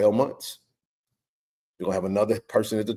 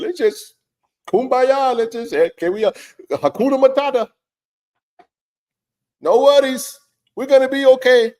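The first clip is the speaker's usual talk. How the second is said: much higher, singsong mock-carefree voice; mock the leaders' happy talk, pitched way up; sarcastic mock cheer; 14 semitones higher, 7 dB louder than usual. Host mocking complacent leaders, high sarcastic singsong.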